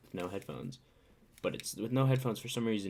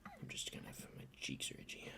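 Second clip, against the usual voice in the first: whisper; 13 dB quieter than usual. Real, not acted. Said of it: "I'm not crying".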